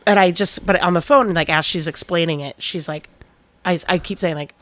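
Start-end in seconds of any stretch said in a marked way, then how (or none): none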